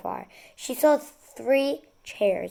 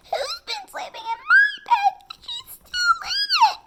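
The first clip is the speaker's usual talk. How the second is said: much higher, tearful tiny cub; crying baby bear; 4 dB louder than usual. A narrator's reading. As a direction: wail it like a little bear in tears. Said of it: "And then Baby Bear cried".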